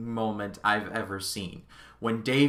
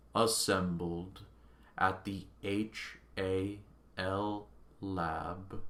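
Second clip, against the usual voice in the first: very slow, low, softer dying machine; failing computer voice; 6 semitones lower, 9 dB quieter, slower than usual. Retold or imitated. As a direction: recite it like a running-down robot. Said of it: "reverts back to his initial programming".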